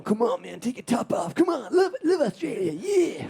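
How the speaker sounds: hammed-up impression of an eager pal; Des Bishop impression. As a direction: egg a friend on in an exaggerated voice. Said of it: "That's my Des impression".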